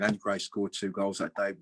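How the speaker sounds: normal talking voice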